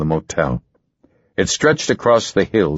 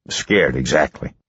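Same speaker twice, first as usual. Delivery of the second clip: lower-pitched husband; lightly voiced husband, pitched down; husband's voice; 5 semitones lower than usual. A narrator's reading. Narrator voices the husband's denial.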